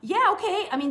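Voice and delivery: normal talking voice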